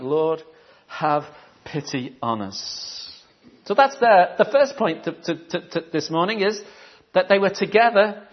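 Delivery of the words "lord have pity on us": slow pleading. Retold or imitated. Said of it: "And they just had".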